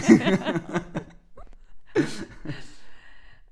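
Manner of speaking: long, breath-heavy laugh; laughter. Real, not acted. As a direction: laugh it out until it fades. Laughs after promising later discussion.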